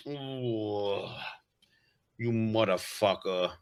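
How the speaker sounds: low, slow mafioso snarl; lightly hammed-up villain; bad guy voice. Playing the mafioso bad guy he gets cast as, low snarl. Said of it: "hard not to be like".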